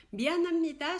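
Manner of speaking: 미안합니다 said loud and high; emphatic. Shown as a demonstration. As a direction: punch 미안합니다 to contrast it.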